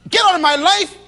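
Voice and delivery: loud, high, cutting shout; harsh phone brush-off. Acted. Husband on the phone to the sweetheart, a harsh shout. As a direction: slam the door on her with your voice.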